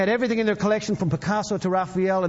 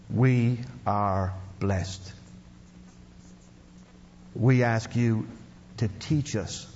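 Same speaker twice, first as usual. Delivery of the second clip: low, slow, hushed prayer voice; closing prayer; 6 semitones lower than usual. Acted. Preacher praying over the congregation, low slow hushed prayer.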